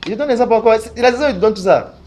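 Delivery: hammed-up pastor voice; prophesying pastor. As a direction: promise the girl a job in God's name. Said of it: "Je vais dire à la fille".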